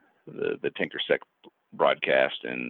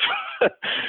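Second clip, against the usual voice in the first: short laugh. Real, not acted. Laughs at own joke.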